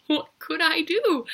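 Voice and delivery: high, loud anguished cry; desperate cry. A narrator's reading. Killer panicking as the noise grows, desperate loud cry. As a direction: cry out helplessly.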